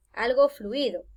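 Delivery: normal talking voice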